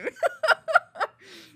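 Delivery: high laughing burst; laughter. Real, not acted. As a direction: keep laughing helplessly. Still laughing.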